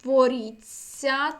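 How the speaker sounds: боріться slow and loud, ending in цьця; long soft ts. Shown as a demonstration. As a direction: stretch боріться with тьс as long ць. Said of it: "«ць»". (повільно боріться, з довгим м'яким ць)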